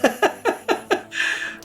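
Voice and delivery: airy high-pitched laugh; breathy high giggle. Real, not acted. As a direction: let a light giggle slip out. Laughing at his own joke.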